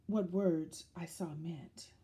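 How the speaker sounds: ordinary delivery, nothing marked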